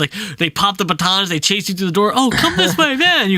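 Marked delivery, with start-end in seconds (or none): 2.1-3.3 high-pitched hurried call; urgent beckoning. Retold or imitated. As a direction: play someone urging a player to flee. Game character urging escape in retelling.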